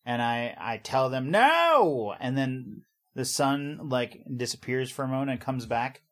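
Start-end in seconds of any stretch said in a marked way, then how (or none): 1.3-2.1 sudden high loud command; commanding shout. Pretend. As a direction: shout it like you command the sun. Commanding the eclipse.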